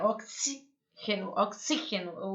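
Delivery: first "Oxígeno" broken after "xí", softly; stressed middle syllable. Shown as a demonstration. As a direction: show the strong "xí" in "oxígeno", pausing after it. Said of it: "a sílaba forte é o".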